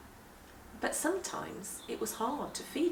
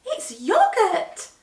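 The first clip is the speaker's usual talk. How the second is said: much higher and louder, slow and delighted; thrilled discovery; 10 semitones higher, 10 dB louder, slower than usual. Acted. Pippa telling everyone excitedly that it is yoghurt. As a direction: announce it with glee.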